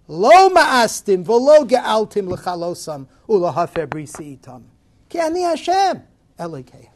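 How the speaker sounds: proclaiming the verse. Acted. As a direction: build the quote to a climax.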